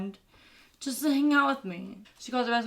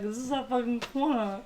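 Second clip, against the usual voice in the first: softer, level retort; understated turf claim; 5 dB quieter than usual. Retold or imitated. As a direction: claim the corner without raising the voice. Roommate's take in the turf quarrel.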